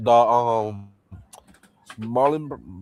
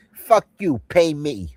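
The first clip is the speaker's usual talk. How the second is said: higher, louder mock mob demand; higher, louder hammed-up mobster line; 5 semitones higher, 5 dB louder, slower than usual. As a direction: spit it out pitched up and louder. Quoting famous line.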